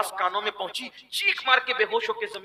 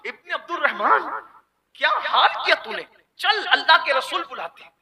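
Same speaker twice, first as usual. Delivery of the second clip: hearty call; 7 semitones higher, 5 dB louder than usual. Acted. Umar calls the youth.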